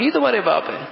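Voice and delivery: high-pitched question peaking before the end of یہ تمہارے باپ ہیں; really ask, pitching باپ high. Shown as a demonstration. Preacher putting a question to the child, questioning. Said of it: "یعنی سوال کر رہا ہوں".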